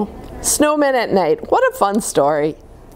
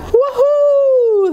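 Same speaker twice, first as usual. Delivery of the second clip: high, loud joyful whoop; excited yell; 4 dB louder than usual. Acted. Snowmen sledding down the hill, gleeful high whoop. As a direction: whoop like a kid on a sled. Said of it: "they yell".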